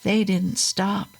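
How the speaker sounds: ordinary delivery, nothing marked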